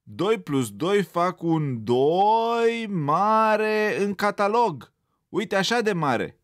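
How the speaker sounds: teacher threatening a bad grade; menacing mockery. A narrator's reading. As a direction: drag it out to scare him. Teacher mocks with big grade.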